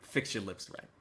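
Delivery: a hushed mock reprimand; imitating Curtis King. Streamer mimics host telling him to shut up, hushed. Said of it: "Curtis King is like".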